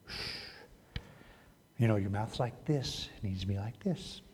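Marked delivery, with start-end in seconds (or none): 0.1-1.0 soft shush. Shown as a demonstration.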